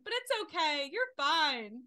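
high, loud, sugary reassurance; a touch of fake calm to the driver; mock-sweet calm. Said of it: "very calmly explain to her".

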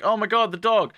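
high-pitched exclamation; mock alarm. Girls seeing the dog go behind a door, mock alarm. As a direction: cry it out high, in play alarm.

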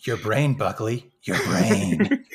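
low, drawn-out spooky voice; hammed-up low menace; mock-menacing caller. Playing the sinister caller to Buckley, mock-menacing.

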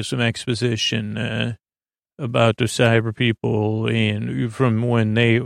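ordinary delivery

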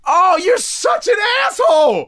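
high, slow outraged yell; outraged shout. Real, not acted. Mock outrage at being insulted.

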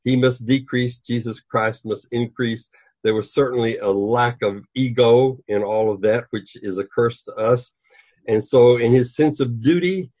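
ordinary delivery

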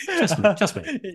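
high, laughing repeat of just me; chuckling it out. Real, not acted. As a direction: laugh through the words. Host laughs at his own joke.

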